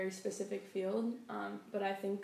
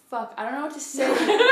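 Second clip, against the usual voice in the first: loud, laughing and flustered; 6 semitones higher, 14 dB louder than usual. Real, not acted. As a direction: burst out laughing at your own blank. Flustered speaker loses her words.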